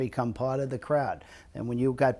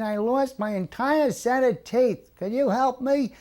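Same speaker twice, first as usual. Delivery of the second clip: put-on fretful old man; 11 semitones higher, 3 dB louder than usual. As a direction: plead like an old man who lost his teeth. Old man lost his teeth.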